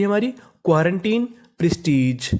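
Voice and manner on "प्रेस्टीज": normal talking voice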